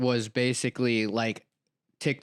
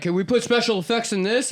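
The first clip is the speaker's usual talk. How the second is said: mock director; 9 semitones higher, 3 dB louder than usual. Quoting director, pitched up.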